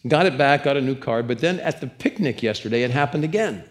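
ordinary delivery

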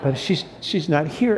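soft, choked-up, laughing through tears; real tears breaking through a small laugh; quiet and teary, missing his wife; tearful. Real, not acted. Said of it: "I don't know why I'm crying about it now".

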